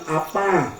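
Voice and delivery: a quiet, calm answer; soft reply. Retold or imitated. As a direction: answer quietly, changed man. Changed man answers softly.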